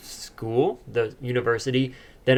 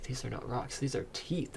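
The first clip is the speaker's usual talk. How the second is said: horrified; 14 dB quieter than usual. Retold or imitated. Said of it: "like, a horrified".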